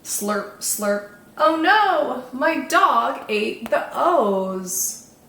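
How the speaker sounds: slow, sing-song picture-book narration; children's story reading. A narrator's reading. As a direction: read it to little ones, savouring each rhyme.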